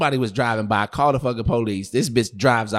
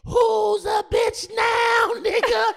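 very high, drawn-out taunting yell; mock furious shout; much slower than usual. Storyteller imitating girlfriend grabbing his face, high mock shriek. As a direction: stretch it into a slow, shrieked challenge.

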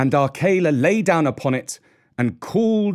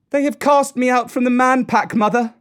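high, raised calling voice; shout; 11 semitones higher, 4 dB louder than usual. A narrator's reading. Mowgli calls to Mother Wolf at her cave, shouting. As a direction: call out strongly to someone in a cave. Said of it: "shouted Mowgli".

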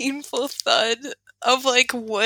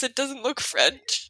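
ordinary talk, nothing marked

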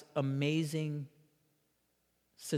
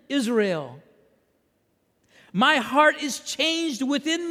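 high, loud plea in God's words; impassioned preaching; 9 semitones higher, 8 dB louder than usual. Acted. Preacher voicing God to wayward Israel, impassioned plea.

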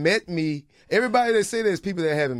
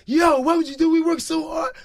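imitating [name]; 10 semitones higher, 6 dB louder than usual. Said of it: "[name], like".

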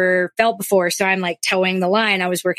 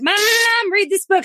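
put-on high, whining child voice; kids' whiny plea; 11 semitones higher than usual. Mother voicing her kids begging her to read, whiny child plea. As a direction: mimic the kids begging for a story. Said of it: "they all are like".

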